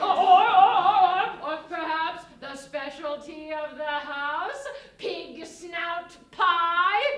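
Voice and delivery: loud wavering stall, then slow drawn-out reveal; grand sales pitch. Acted. Innkeeper offers the house specialty to guests, slow grand reveal. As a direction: build up to the specialty and stretch it out.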